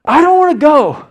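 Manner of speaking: high, loud, anguished pleading; impassioned protest through imitated tears; tearful son. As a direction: act out a distraught son, dragging the words out. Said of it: "And he just tears in his eyes".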